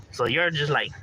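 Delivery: ordinary delivery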